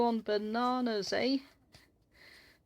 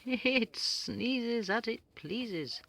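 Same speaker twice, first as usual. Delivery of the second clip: slow sing-song rhyme; 3 semitones higher, much slower than usual. Real, not acted.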